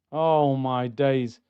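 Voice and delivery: fed up with a shambles of a game; weary dismay. Real, not acted. Sighing over poor performance.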